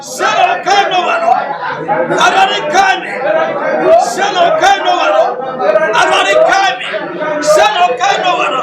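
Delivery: loud, high fervent prayer chant; preaching at full force. Real, not acted. Pastor leading the congregation in a loud prayer chant. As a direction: cry out each plea for the church to echo.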